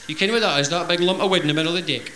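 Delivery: usual speaking voice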